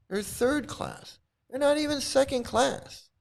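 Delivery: real incredulous hurt, pitched high; appalled disbelief. Real, not acted. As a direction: stretch it out in pained disbelief. Appalled at the quoted ranking.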